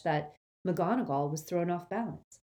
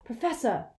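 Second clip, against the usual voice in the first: shouting; 7 semitones higher than usual. A narrator's reading. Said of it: "Harry shouted".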